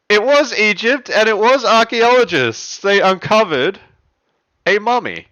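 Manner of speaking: loud, high, thrilled announcement; excited reveal. Real, not acted. Host revealing the riddle answer to players, excited and loud. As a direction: announce it loudly, still buzzing.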